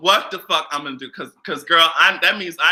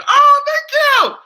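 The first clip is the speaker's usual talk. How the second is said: squealing thanks; 7 dB louder, much slower than usual. Real, not acted. Reacting to a compliment.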